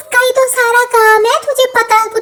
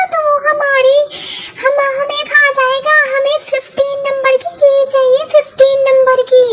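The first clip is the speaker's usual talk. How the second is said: a higher, slowed hushing voice; Kamla's higher voice; 3 semitones higher than usual. Acted. Kamla hushing her partner so the monster won't hear, higher voice. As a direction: hush your partner nervously, pitched up.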